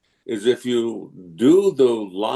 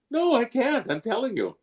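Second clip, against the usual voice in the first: mock protesting; 13 semitones higher than usual. Actor arguing he can't manage it, mock protesting. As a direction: plead helplessly in a squeezed high voice. Acted argument over the accent.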